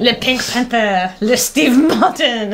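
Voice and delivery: hammed-up fake French accent; abysmal French accent. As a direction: mangle it like a phony Frenchman. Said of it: "French accent that is truly abysmal".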